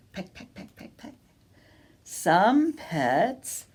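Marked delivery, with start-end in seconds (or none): 0.1-1.1 whispered quick pecks; faint playful pecks; soft pecking. Pretend. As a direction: tap the words out softly like a beak. Acting out pecking softly.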